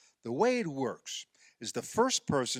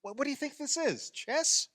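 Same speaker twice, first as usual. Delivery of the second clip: mocking tease; 11 semitones higher than usual. Teasing her slow shot.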